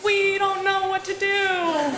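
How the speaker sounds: high, slow, laughing helpless voice; mock the clueless people outside; lightly hammed-up bewildered reply; helpless bystanders. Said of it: "they're like".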